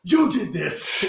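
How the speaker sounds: high, loud, drawn-out accusation; mock accusing shout. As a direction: play-act a furious confrontation. Acted accusation in imagined Starbucks fight.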